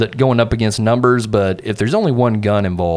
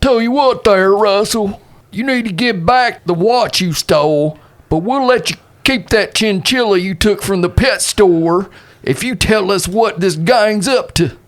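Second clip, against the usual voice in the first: higher, drawling cowboy lawman; folksy lawman; 10 semitones higher than usual. Acted. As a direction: sweet-talk him like an old-west sheriff. Player voices Dave persuading.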